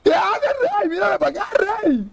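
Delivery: very high-pitched startled shout; startled yelp. Real, not acted. Gamer to another player, high startled yelp. Startled yelling during a game.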